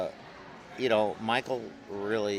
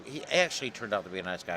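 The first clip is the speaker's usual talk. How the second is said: ordinary talk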